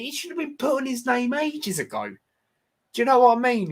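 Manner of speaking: incredulous, voice shooting up high; exasperated. Real, not acted. Vlogger fuming that Moyes got respect so late, exasperated.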